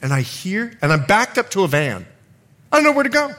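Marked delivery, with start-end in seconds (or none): 2.7-3.3 high-pitched, louder cornered cry; retold panic. Preacher acting out being backed against a van, retold panic.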